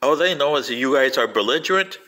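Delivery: ordinary talk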